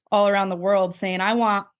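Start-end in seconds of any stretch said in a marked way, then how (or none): none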